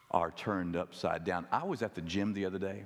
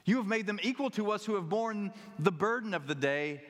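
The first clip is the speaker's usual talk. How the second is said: higher, outraged complaint from tired workers; indignant grumbling; 10 semitones higher than usual. Retold or imitated. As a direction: protest the unfair pay, voice raised high. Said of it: "They grumbled at the landowner".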